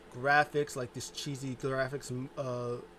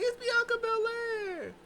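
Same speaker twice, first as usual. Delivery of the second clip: very high, slow announcement; announcer voice; slower than usual. Retold or imitated. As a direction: announce her in falsetto. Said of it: "She's like".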